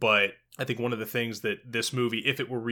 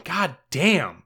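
higher, louder mock-exasperated swear; retold exasperation; 5 semitones higher, 4 dB louder than usual. Podcaster recalls reacting to the film, exasperated.

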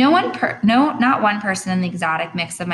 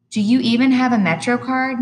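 louder, higher call toward the door; calling after; 7 semitones higher, 6 dB louder than usual. Acted. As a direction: call after her as she leaves. Mom calling after daughter.

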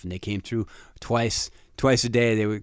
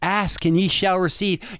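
slow, higher, lightly voiced youth pastor quoting scripture; 8 semitones higher, slower than usual. Youth pastor in his memory quoting scripture, slow and lightly preachy. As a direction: recite it slowly like a kindly preacher. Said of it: "youth pastor whispering in my ear".

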